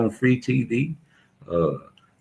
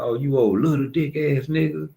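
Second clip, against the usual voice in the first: mocking girlfriend; 6 semitones higher than usual. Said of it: "my bitch, uh, was in front of her friends".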